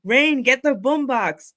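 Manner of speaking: high, loud, drawn-out command; calling out. Acted. Host acting out a scene with Rain, calling out. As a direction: call out like a cue to a sidekick.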